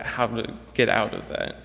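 ordinary talk

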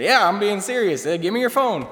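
imitating a Texan rancher with a light Southern accent; Southern twang. Telling the students how Harvey insisted, in a lightly imitated Southern accent. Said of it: "He talked with an awesome Southern twang".

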